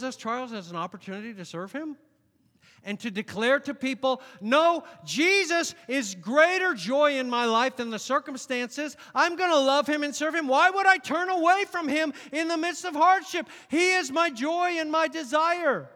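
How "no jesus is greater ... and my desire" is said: bold declaration. Acted. Voicing a believer declaring faith.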